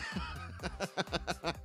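soft, breath-filled real laugh; quiet wordless chuckling; amused chuckle. Real, not acted. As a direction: chuckle softly to yourself. Laughs thinking about Terry.

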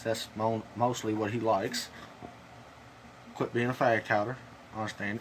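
speaking around tobacco pinch; slightly thick lip sounds. Real, not acted. Seen 1: Lower lip looks bulged throughout while he reviews tins.

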